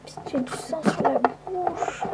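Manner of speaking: chuchote, lent et affolé; cache le sang en soufflant; chuchoté pressé. Acted. Panique chuchotée, plus lente. (chuchotement paniqué)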